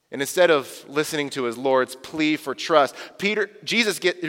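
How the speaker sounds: ordinary talk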